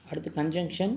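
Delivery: usual speaking voice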